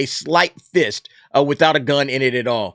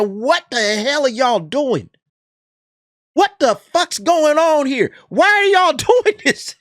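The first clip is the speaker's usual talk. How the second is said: much higher, slow, incredulous mocking voice that climbs and cracks into a laugh; mock outraged critic; 9 semitones higher than usual. YouTuber voicing Screen Rant scolding Amazon, high mock outrage. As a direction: play the site as a squeaky, exasperated scold. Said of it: "Screen Rant is saying".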